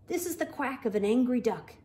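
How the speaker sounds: lively, animated bedtime story reading; picture book read-aloud with voices; reading to children. A narrator's reading. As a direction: read it bright and playful for little kids.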